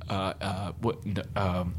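acting out a tongue-tied reply; flustered stammer. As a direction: stall awkwardly, caught off guard. Stormtrooper flustered when asked about project.

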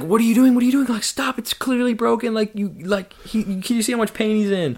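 high-pitched, urgent protest; imitating outraged dad. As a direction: act out a father demanding the doctor stop. Retold dad protesting to the doctor.

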